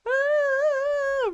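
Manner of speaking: high drawn-out imitated wail; child's wail. Mom imitating her daughter's muffled cry from the closet, wailing.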